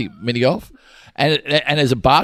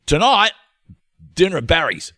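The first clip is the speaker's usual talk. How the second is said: chirpy high invite; cheerful mate announcing dinner plans; mate's invite; 7 semitones higher, 4 dB louder than usual. Acted. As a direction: sell the dinner plan brightly.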